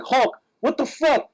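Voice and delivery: high, slow mock outrage; indignant confrontation. Acting out confronting Hulk.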